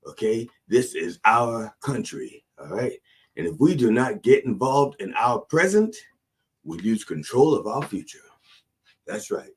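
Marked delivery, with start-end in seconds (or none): none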